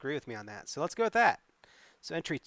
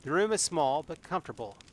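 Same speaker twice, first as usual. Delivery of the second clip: story narration; 3 semitones higher than usual. A narrator's reading. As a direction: read the adventure like a tale to the audience. Reads gamebook narration with May's lines.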